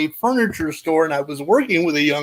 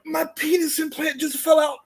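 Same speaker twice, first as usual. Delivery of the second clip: mock distress; 7 semitones higher, 5 dB quieter than usual. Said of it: "I'm like".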